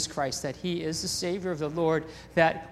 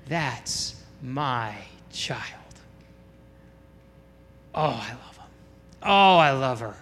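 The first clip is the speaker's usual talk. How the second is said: tender fatherly love; 5 semitones lower than usual. Acted. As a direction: savor each word like a doting father. Voicing God the Father's love.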